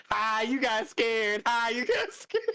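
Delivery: slow, high, whiny mock taunt. Friend behind her in the crawlspace, teasing in a slow, high whine. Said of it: "she was like".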